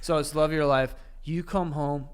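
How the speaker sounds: usual speaking voice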